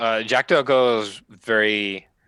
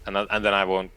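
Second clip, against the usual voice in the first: ordinary talk, nothing marked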